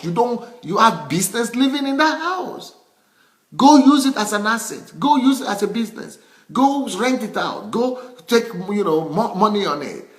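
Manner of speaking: high-pitched, insistent urging; emphatic exhortation. Real, not acted. Telling overspenders to rent the house out, high and insistent. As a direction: push each command up high, exasperated.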